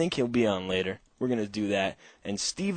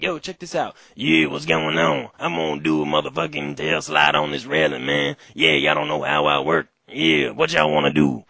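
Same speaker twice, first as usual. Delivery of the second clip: a hint of a swaggering dude, louder; kids doing Big Eddie Ed; 3 semitones higher, 6 dB louder than usual. Host mimicking kids' Big Eddie Ed impressions. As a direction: brag it like a cocky skater. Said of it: "do their Big Eddie Ed impressions".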